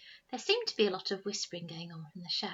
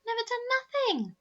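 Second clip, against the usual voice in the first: high, loud whiny protest; 6 dB louder than usual. Acted. Youngest Snargett boy protesting to his eldest brother. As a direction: protest your innocence shrilly in a high voice. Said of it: "protested the youngest".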